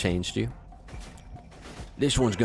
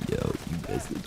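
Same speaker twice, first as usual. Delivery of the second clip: soft low voice; 5 semitones lower, 6 dB quieter than usual. Acted.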